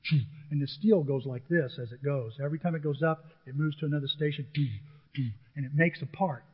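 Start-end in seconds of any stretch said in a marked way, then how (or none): 0.0-0.2 short punching "choo" of a stamping press; evenly spaced machine puffs; imitated press noise. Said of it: "A press goes". 4.6-5.3 short punching "choo" of a stamping press; evenly spaced machine puffs; imitated press noise. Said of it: "A press goes".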